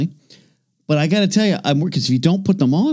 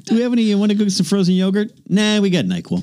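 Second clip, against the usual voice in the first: mock casual chat; 8 semitones higher, faster than usual. Mock couple dialogue.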